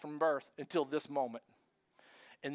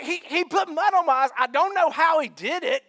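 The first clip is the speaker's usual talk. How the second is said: excited testimony; 4 semitones higher, 7 dB louder than usual. Retold or imitated. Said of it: "he's like".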